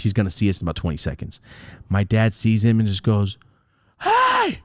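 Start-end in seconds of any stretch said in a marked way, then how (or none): 4.0-4.6 high-pitched retold holler; imitated sharp call. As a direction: flag down someone far off. Father calls out to cop.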